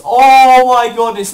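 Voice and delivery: loud, stretched exclamation; shocked outburst. Real, not acted. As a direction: burst out in alarm, drawing it out. Shock at enemy health.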